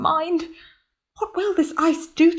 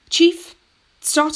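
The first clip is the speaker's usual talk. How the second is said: higher, louder call; 6 semitones higher, 5 dB louder than usual. Acted. Captain summoning his chief engineer, calling out. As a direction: call him over across the deck.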